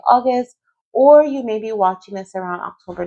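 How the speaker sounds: ordinary talk, nothing marked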